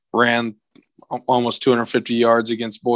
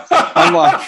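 high squeal; 6 dB louder than usual. Real, not acted. Squeal of disbelief.

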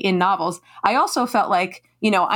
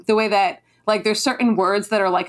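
ordinary talk

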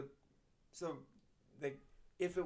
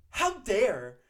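much louder, higher mock outrage, laughing; mock offended; 8 semitones higher, 16 dB louder than usual. Teacher quoting a student's mock outrage, laughing and loud. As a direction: ham up a kid's mock indignation.

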